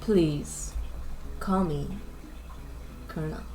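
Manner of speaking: low, husky seductive man voice; sexy man voice. Acted. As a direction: purr it like a ladies' man. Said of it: "I need a sexy man voice".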